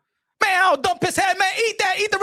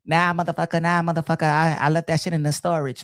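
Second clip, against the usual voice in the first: mock wife refusal; 8 semitones lower than usual. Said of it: "answer as the wife".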